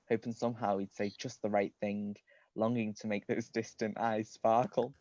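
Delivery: ordinary delivery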